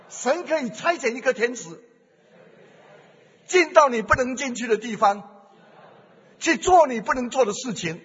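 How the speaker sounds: loud fervent preaching, pressing each phrase; preaching at full force. Acted. Preacher urging the church to pray, loud and fervent. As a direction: preach it out with fervour.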